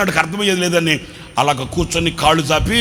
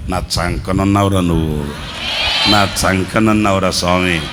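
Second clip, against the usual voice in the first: much lower, gentle voice of God; a hint of a fatherly God reassuring; God speaking tenderly; 12 semitones lower than usual. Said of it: "దేవుడు అంటాడు".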